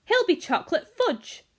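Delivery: high, loud outburst; shrieked protest. A narrator's reading. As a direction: shriek in horrified disbelief. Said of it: "shrieked Missus Gloop".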